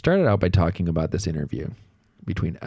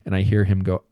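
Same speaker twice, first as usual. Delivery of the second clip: usual speaking voice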